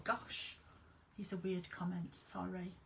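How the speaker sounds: breathy whisper to herself; a hushed aside to herself. Real, not acted. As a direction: say it under your breath.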